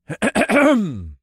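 loud, dramatic ahem; showman's attention-getting ahem; theatrical throat clearing. A narrator's reading. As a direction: clear the throat before a big announcement. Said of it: "he cleared his throat".

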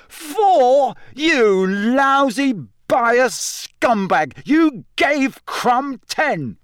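high-pitched, drawn-out outrage; furious bellow. A narrator's reading. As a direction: hurl it slowly in high indignant fury. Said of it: "Ron bellowed furiously".

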